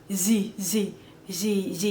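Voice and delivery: "Z Z Z Z" said as a buzzing French z. Shown as a demonstration. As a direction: buzz "Z Z Z Z" like a French TH. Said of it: "When they say a T H".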